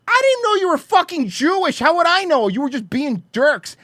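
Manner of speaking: high, loud, hammed-up outraged shout; indignant protest. Bar owner defending himself against a racism accusation, high indignant yell. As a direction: protest at the top of your range, mock outraged.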